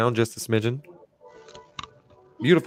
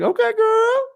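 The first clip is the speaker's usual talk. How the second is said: very high, louder mock reply; playful retort; 6 dB louder, slower than usual. Husband playfully answering his wife's salt and pepper compliment, playful high retort. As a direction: squeal it in a playful falsetto.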